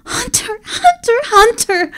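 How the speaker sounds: very high, bouncing repeated calls; giddy repeated name; excited calling. Acted. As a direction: call out again and again in glee.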